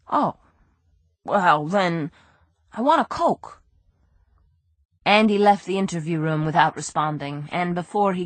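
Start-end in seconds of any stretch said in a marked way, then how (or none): 0.1-3.6 whining. Acted. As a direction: whine it like a spoiled crook. Brady voice.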